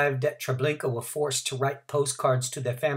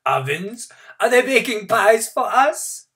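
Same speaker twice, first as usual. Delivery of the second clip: mocking; 10 semitones higher, 9 dB louder than usual. A narrator's reading. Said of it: "somebody said".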